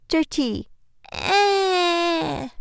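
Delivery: put-on high crying child's whine; imitating the child's whine. Mom imitating her son's whiny cry, high and tearful. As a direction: whimper it like a toddler. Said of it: "I hear a little".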